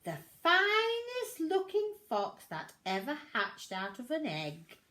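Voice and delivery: lightly voiced sly fox; a hint of a smug, smiling fox. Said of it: "smiled the fox".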